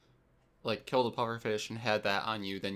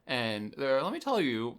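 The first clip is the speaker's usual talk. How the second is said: usual speaking voice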